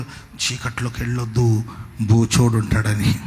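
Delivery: low, slow, ominous caution; mock bogeyman warning. Mother warning her child about darkness, low slow mock-spooky voice. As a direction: draw it out low as if scaring a small child.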